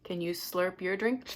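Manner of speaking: ordinary delivery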